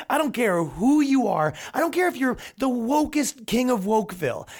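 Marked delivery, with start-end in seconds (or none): 1.7-4.5 mocking. Real, not acted. Mocking woke listener.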